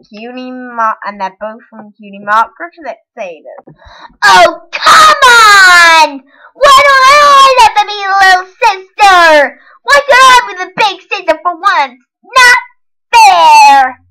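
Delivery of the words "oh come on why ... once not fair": high, loud little-sister whine; Sweetie Belle. Acted. Little sister protesting unfairness, high shouted character tantrum. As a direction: wail it in protest, stretching the last words. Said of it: "It was Sweetie Belle".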